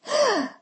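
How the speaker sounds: high sharp gasp of amazement; loud amazed gasp. A narrator's reading. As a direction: gasp in delight. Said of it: "she gasps with amazement".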